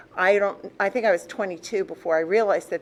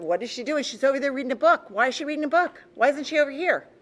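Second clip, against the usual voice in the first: higher, louder, quick gossiping aunts; nosy Italian aunts; 8 semitones higher, 4 dB louder, faster than usual. Retold or imitated. Aunts asking mother about the girl reading, higher and quicker. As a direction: rattle it off like a nosy aunt at Sunday dinner. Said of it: "but if they were talking in Italian".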